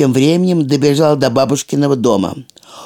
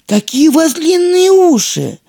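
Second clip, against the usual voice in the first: amazed; 12 semitones higher than usual. A narrator's reading. Girl amazed at grandma.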